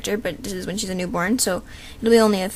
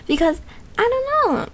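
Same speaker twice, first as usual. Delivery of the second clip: a hint of a much higher cartoon cat; the cat's voice; 13 semitones higher than usual. Shown as a demonstration. Girl showing she can voice the cat, much higher. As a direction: slip briefly into the cat's high voice. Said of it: "I can sound like him".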